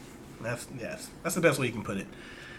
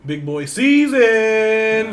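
slow, loud, much higher drawn-out proclaim; hyped announcement; 9 semitones higher, 11 dB louder, much slower than usual. Acted. Host proclaims the email subject line, slow loud hype. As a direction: stretch it out like a big title.

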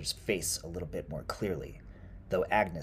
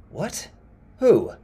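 loud, high, baffled questions; startled questioning; 13 semitones higher, 16 dB louder than usual. Acted. Woodcutter to the old woman, startled by her words. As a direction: blurt it out in confusion.

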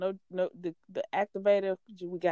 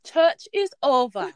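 higher, hammed-up British church announcer; wobbly mock-British accent on a raised pitch; attempted British accent; 6 semitones higher, 4 dB louder than usual. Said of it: "Not really sure what this accent is".

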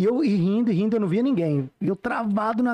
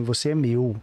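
a deep mock voice in the head; imitated inner voice; 7 semitones lower, slower than usual. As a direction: drop low and claim them firmly. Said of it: "Falando bem alto assim".

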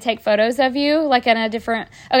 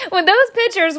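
high, words pushed out through leftover laughter; still laughing; 8 semitones higher than usual. Real, not acted. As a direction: try to talk past the giggles. Laughing recalling daughter's photo.

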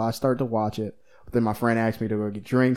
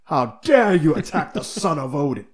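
booming offended god, grand and theatrical; hammy stage actor; 3 semitones higher, 4 dB louder than usual. Retold or imitated. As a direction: play it like an outraged Shakespearean king. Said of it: "and he's like".

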